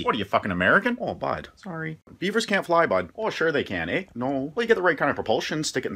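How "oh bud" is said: normal talking voice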